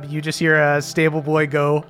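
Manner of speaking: ordinary talk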